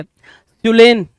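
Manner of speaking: normal talking voice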